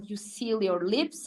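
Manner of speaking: ordinary talk, nothing marked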